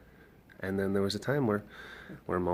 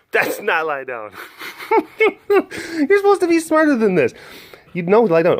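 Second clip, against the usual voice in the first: much higher and louder, teasing; high playful scold; 14 semitones higher, 13 dB louder than usual. Real, not acted. Training the dog, high playful scold.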